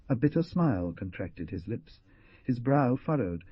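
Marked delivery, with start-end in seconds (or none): none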